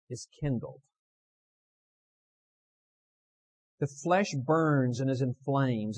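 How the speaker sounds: slow, stretched, emphatic pulpit reading; dramatic quotation reading. Acted. Preacher reading from a manuscript on temptation to the congregation, dramatic reading. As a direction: read it out weightily, drawing out key words.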